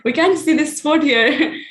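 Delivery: retold kid's amazement, laughing through it; surprised child. Boy spotting sunspots through the telescope, imitated laughing surprise. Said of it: "he was like".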